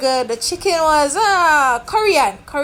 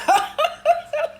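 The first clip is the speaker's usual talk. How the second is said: laugh. Real, not acted. Laughs at deportation fear.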